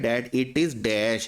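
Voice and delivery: very slow, each word separated; teacher reading a sentence slowly; slow dictation. Shown as a demonstration. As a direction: spell it out slowly for the class. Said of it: "ये अच्छा होता है".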